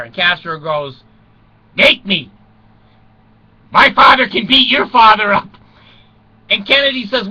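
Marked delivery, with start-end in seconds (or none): 1.7-5.5 loud, higher schoolyard dare; childish taunt. Retold or imitated. Castro to Kennedy, a loud childish taunt. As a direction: taunt him like a kid on the playground.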